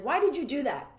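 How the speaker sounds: mock-accuse as if catching them out; accusing why. Retorting to a client who claims curiosity, accusing.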